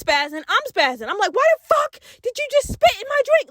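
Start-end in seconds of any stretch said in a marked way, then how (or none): 1.3-3.5 very high, outraged yell; mock furious yell. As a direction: yell at him, pitched way up in fury. Yelling at cousin about spit.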